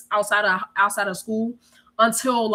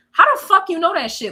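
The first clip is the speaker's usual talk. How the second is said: high, loud retold disbelief; incredulous demand; 8 semitones higher, 5 dB louder than usual. Teen asking cousin how she knew mom was listening, incredulous. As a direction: blurt the question in amazement. Amazed questioning of her cousin.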